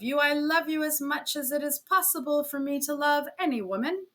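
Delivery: lightly voiced fiancé's letter, tearful. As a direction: read the letter as if it wounds her. Reading the painful letter.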